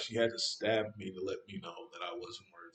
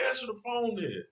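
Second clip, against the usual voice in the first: high-pitched exasperated demand; angry retort. Retold or imitated. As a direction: cut back at the pleading, voice leaping up. Angry retort to her pleading.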